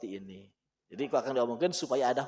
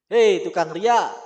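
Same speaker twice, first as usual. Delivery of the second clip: slow, louder mock calling out; a slow, louder taunt at Malik bin Dinar; woman calling out; 2 semitones higher, 7 dB louder, slower than usual. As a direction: drag out the taunt like a mocking woman. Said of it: "Ada seorang cewek ngomong ke Malik bin Dinar".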